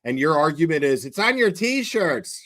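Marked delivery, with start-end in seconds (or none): 1.0-2.4 mocking. Mocking the other side's argument.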